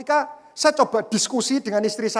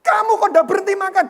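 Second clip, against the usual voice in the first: higher, louder mock scolding; husband retelling his wife scolding him in a raised voice; imitating wife's angry reproach; 8 semitones higher, 5 dB louder than usual. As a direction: huff at him like the annoyed wife. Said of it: "istri saya marah".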